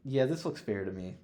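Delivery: ordinary talk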